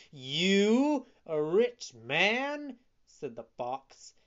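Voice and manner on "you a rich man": high, loud, drawn-out disbelief; scornful. A narrator's reading. Fox ridiculing Pinocchio's claim to be rich, scornful. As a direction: stretch the words into a jeer. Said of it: "began to laugh rudely and scornfully".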